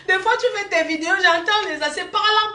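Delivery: giggling through the words; laughing. Real, not acted. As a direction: tease while laughing. Teasing while laughing.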